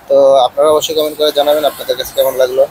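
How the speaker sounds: filtered outdoor lav mic sound; noise reduction artifacts. Real, not acted. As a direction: voice through noise cancelling mic. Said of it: "এতক্ষণ এই মাইক্রোফোনটার outdoor ... শুনলেন". (Noise ক্যান্সেলেশনের প্রভাব)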